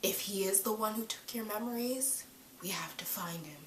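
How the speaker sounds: hushed, intense soap-opera delivery; melodramatic soap opera. Retold or imitated. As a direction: drop to a dramatic near-whisper. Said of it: "all that melodramatic soap opera feeling".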